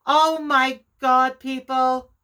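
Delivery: stunned by a sudden discovery; sudden realization. Real, not acted. Crafter to viewers as she spots her mistake, stunned.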